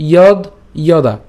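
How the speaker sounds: slow, separated words with lengthened vowels; broken-up recitation. Shown as a demonstration. Teacher shows the split verse, slow careful recitation. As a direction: pause between each piece of the verse. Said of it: "শ্লোকটি ভেঙে দিয়েছি".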